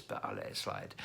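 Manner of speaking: soft, low whispered joke; whispered aside. Real, not acted.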